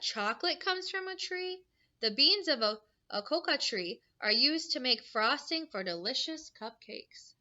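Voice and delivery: bright storybook read-aloud; reading to children. Acted. Teacher reading a book to her class, bright and lively.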